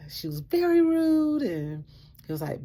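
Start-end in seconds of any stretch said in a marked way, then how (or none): 0.1-1.4 high, loud and slow complaint; upset wife to husband; indignant insistence. Retold or imitated. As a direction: lean on it, still fuming.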